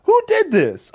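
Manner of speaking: high, baffled "Who did this?"; puzzled at the mess; confused. Retold or imitated. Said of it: "And I'd be like".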